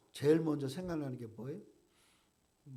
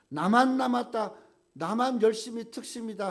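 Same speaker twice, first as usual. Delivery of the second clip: emphatic; 7 semitones higher, 8 dB louder than usual. Acted. Preacher voicing Elijah's complaint.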